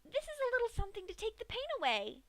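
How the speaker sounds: high, fast, sweet pony voice; Pinkie's voice. Acted. Pinkie explains the syringe to Dash, high quick sweet voice. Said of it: "Pinkie informed Dash".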